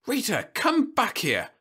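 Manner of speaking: high, drawn-out mother's call; slow, high-pitched scolding call; angry calling. A narrator's reading. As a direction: call a straying child back crossly. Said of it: "a woman in the third rank of the crowd called angrily".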